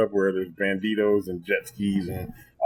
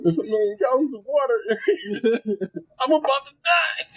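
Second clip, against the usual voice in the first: very high, panicky squeal; hammed-up terror, pitch climbing to the end; mock panic; 14 semitones higher than usual. Non-swimmer thinking he is about to drown, mock panic. Retold panic in sinking boat.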